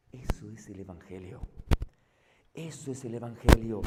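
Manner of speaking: soft and low, letting it sink in; hushed repetition. Real, not acted. Preacher softly driving home his point to listeners. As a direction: say it quietly, twice, with weight.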